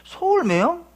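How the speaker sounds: high questioning rise, not shouted; acted-out doubtful double take; puzzled echo. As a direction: repeat it to yourself, baffled. Puzzled reaction to being hailed.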